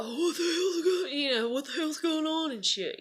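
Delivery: high-pitched mock dad outburst; griping dad. Dad returning to the store scene, imitated griping. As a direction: imitate an exasperated father griping. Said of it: "he's bitching about it".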